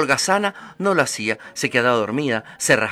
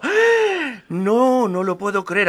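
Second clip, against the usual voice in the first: astonished; 7 semitones higher, much slower than usual. Acted. Mother sees the gold.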